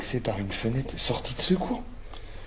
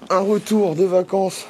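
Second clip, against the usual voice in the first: higher, louder, slow title read; drawn-out announcement; 7 semitones higher, 6 dB louder, slower than usual. Shown as a demonstration. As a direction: stretch it like a show title. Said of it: "Excuse-moi".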